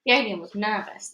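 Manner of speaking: plain reading voice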